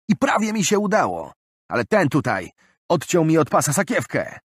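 high, loud, pleading complaint; distraught robbed man. Acted. Robbed man telling Tarik about his savings, high and distraught. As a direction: pour out the loss, near tears.

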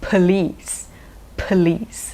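"police" with a reduced "เพอ" first syllable; schwa in police. Shown as a demonstration. Teacher models police with its schwa. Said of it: "อ๋อ ตรงนี้เป็นชวา".

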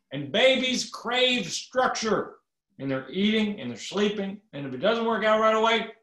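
mock Obama lecturing on babies; exaggerated impression of the president. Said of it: "Then he says".

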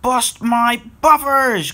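high, loud, drawn-out exclamation; crying out. A narrator's reading. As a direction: cry out slowly in alarm. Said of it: "cried Wisdom".

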